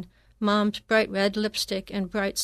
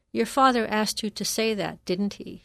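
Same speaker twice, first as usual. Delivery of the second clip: ordinary delivery, nothing marked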